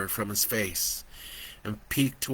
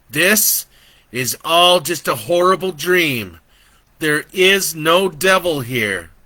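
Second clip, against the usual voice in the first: raised, louder urging; urgent call; 6 semitones higher, 8 dB louder than usual. Acted. Joe urges Brandon the devil isn't real, loud. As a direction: break through to the child.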